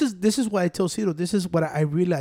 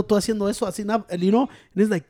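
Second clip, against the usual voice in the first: ordinary delivery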